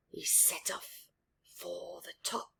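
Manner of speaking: very soft whispered narration; whispering as he sneaks up; tense whisper. A narrator's reading. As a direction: tiptoe the words.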